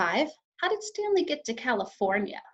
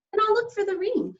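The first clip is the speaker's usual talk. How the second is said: eager; 11 semitones higher, 3 dB louder than usual. A narrator's reading. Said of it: "he said".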